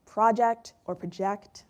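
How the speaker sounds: slow "project or project", first PRO-ject, then pro-JECT; two stresses shown. Shown as a demonstration. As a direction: slowly contrast "project" as the noun and "project" as the verb. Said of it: "A task or to show a film".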